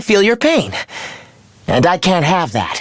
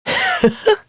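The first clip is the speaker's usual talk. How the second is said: very high, brief villainous giggle; gloating giggle. Acted. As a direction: giggle with smug delight. Villain gloats over mysterious power.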